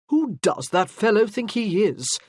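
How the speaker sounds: jealous officer fuming about the Earl; irritated. A narrator's reading. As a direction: bristle at the rival's nerve. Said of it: "his voice irritated".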